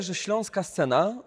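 ordinary delivery

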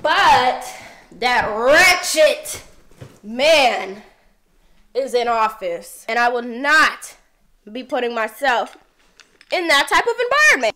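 loud, deliberate, phrase by phrase; indignant declaration. Real, not acted. Vlogger refusing to live under that wretched man, indignant. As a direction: punch each phrase, pausing hard between them. Indignant rant about a politician.

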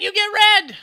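excited shout. Real, not acted. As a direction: announce it like a prize. Handing out a drink excitedly.

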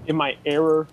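ordinary delivery

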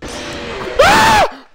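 very high-pitched shriek of fright; startled scream. Real, not acted. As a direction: scream at a jump scare. Jump scare in horror game.

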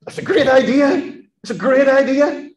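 high, eager approving voice; eager agreement. Retold or imitated. Nathan the prophet eagerly backing the king's plan, high and bright. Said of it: "And Nathan says".

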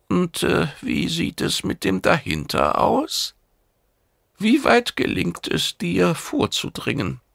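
high, questioning mentor voice; mentor's high inquiring voice; probing mentor. A narrator's reading. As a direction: press the pupil with curious questions. Urkale questions Lekore.

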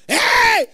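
high loud hey; imitating a worshipper shouting. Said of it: "Person shout".

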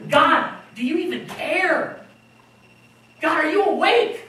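high, pleading cries to God; imitating a desperate prayer, voice pitched high; desperate plea to God. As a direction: beg God to wake up and see. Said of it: "we're like, God".

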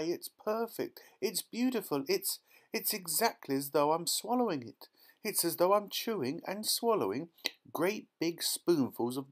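high, gushing rapture; breathlessly thrilled child's raving; ecstatic girl. A narrator's reading. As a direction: relish it with mounting delight. Violet raves about the pie.

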